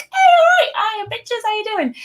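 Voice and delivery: high, sing-out hello to the crowd; high-pitched playful stage hello; chilled-back stage greeting. Acted. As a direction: greet them way up high, casual and cheeky. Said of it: "just chill back and be like".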